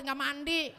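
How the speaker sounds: ordinary talk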